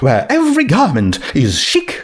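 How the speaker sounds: high, lilting fashionable unicorn; sing-song shop welcome, high and fast; Rarity voice. Acted. Narrator voicing Rarity greeting a customer in a high lilt.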